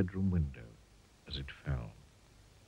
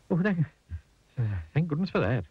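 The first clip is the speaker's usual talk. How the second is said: higher-pitched, faltering relief; relieved; 7 semitones higher, 3 dB louder, faster than usual. A narrator's reading. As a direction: catch your breath after a scare. Relief after the scare.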